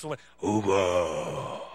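booming, very deep mock-divine voice; Dios. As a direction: call the name low, loud and drawn out like a movie deity. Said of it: "no me habló así como piensan siempre". (voz divina exagerada, grave y retumbante)

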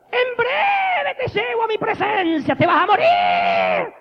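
high, slow, robotic menace building to a shout; a killer machine pronouncing a death sentence; Terminator voice. Retold or imitated. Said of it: "como Schwarzenegger en Terminator". (voz de Terminator, lenta, aguda y amenazante que termina en grito)

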